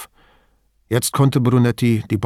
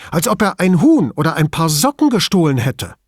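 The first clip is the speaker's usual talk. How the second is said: higher and louder, indignant scorn; more agitated; 6 semitones higher, 4 dB louder than usual. A narrator's reading. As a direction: spit out the word Zugeben with contempt. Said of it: "fuhr noch aufgebrachter fort".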